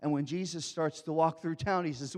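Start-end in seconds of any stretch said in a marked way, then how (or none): none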